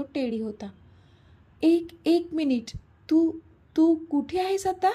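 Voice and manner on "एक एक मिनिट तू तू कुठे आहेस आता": higher, louder, halting in disbelief; stunned, stumbling over the words; shocked. Acted. Girlfriend sees him on her bed, shocked and higher. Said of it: "ती चांगलीच shock झाली होती".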